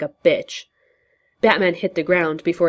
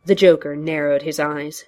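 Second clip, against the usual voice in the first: plain reading voice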